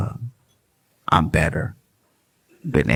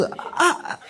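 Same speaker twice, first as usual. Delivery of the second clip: laughing [name]. Retold or imitated. Comic retelling [name]'s reply.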